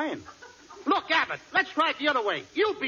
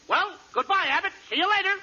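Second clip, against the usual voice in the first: high, excited farewell; high-pitched, hurried sign-off; eager goodbye; 9 semitones higher than usual. Acted. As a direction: dash off with a bright goodbye. Eagerly rushing off after the lesson.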